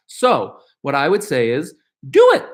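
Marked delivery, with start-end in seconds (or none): 2.1-2.5 pushing a viewer to take the leap; emphatic urging. Real, not acted. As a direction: do it, shouted high and loud.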